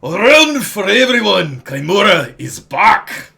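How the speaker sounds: loud cheering tavern crowd call; crowd shout. Acted. Tavern crowd roaring a welcome home. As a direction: bellow it like a rowdy pub crowd.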